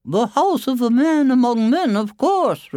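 very high, loud mother voice; high-pitched storybook mother; boy's mother. Acted. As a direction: answer matter-of-course in a high character voice. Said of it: "replied the boy's mother".

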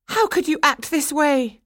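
high, loud reproach; anguished cry. A narrator's reading. Swanhilda confronting Franz, in a high loud cry. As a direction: cry out accusingly at top pitch. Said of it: "she cried".

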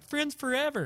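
a high, sing-song graduation cheer; mock cheer. Youth pastor mocking his teenage self at graduation, high and cheery. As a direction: chirp it like a naive grad. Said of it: "I'm like".

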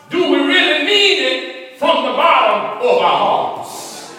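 high, loud, sung preacher's whoop; preaching at full force. Acted. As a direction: rise into a chanted, sung sermon climax.

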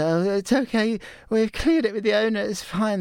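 sheepish excuse to the police; reassuring. Retold or imitated. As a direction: play it off to the cops. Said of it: "we just had to say".

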